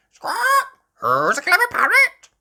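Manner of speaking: very high, loud squawking parrot. A narrator's reading. Polly copying Granny, high squawking parrot. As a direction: screech it like a parrot mimicking. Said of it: "Polly copied".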